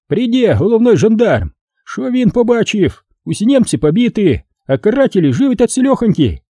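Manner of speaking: high-pitched Ukrainian commissar, worked up; agitated Ukrainian commissar. Acted. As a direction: argue it high and insistent, in Ukrainian-tinged speech. (высокий, возбуждённый голос комиссара-украинца)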